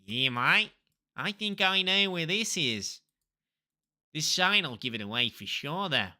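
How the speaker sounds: put-on Aussie, playful; mock Australian tourist-cliché accent. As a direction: talk like a cartoon Australian. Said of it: "I do an accent of where I think I am".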